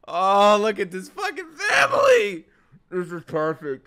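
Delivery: loud, high squeal of delight; overjoyed gushing. Real, not acted. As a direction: shout it out, overcome with adoration. Gushing at the family scene.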